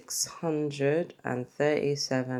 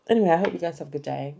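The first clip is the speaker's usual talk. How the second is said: ordinary delivery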